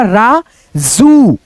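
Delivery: राजू with the final ऊ drawn out very long; stretched long vowel. Shown as a demonstration. Teacher stretching राजू so pupils hear the long ऊ. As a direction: pull out the ऊ of राजू slowly. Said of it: "ज में खींच करके उच्चारण है".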